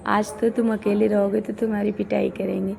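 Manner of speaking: usual speaking voice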